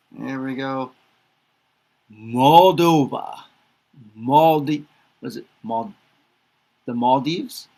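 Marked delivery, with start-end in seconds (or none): 2.1-3.4 slow, louder "Moldova", every syllable clear; teacher modelling "Moldova"; careful pronunciation. Shown as a demonstration. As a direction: model "Moldova" slowly for learners. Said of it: "teaching country names by repetition".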